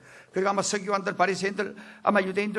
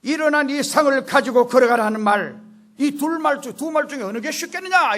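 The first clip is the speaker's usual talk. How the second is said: high, loud preaching delivery; forceful challenge; 7 semitones higher, 9 dB louder than usual. Acted. Jesus challenging the murmuring scribes, forceful. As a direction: throw the question out with authority.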